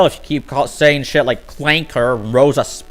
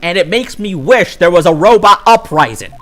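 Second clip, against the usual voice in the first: exasperated rant; 6 semitones higher, 5 dB louder than usual. Real, not acted. Ranting about fake racism.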